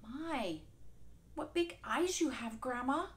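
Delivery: put-on little girl amazed; curious girl. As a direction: marvel at grandma's eyes. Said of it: "she said".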